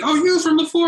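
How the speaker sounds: very high excited mock recognition; retold surprised recognition. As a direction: imitate someone delightedly placing a face. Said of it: "He's like".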